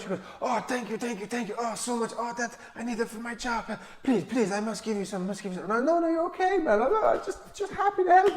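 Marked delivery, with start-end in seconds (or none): 0.4-5.7 high, soft, lightly voiced pleading thanks; grateful shoe shiner. 5.7-8.3 very high, sweet, overdone niceness; mock polite refusal. His own polite refusal.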